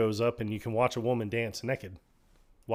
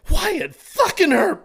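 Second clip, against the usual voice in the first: much higher, louder, drawn-out shout; exclaimed shout; 8 dB louder, slower than usual. Retold or imitated. Rocco cheering the detective, a high, loud, drawn-out shout. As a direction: belt it out in awe.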